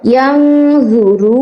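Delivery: ইয়ানজুরু with রু held one alif, slow; one-count stretch on ru. Shown as a demonstration. Teacher shows students the one-count stretch on ইয়ানজুরু. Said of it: "রুতে এক আলিফ টান দিবেন".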